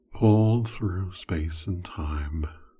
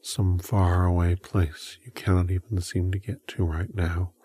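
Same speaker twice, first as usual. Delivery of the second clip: hypnotic whisper induction. Acted.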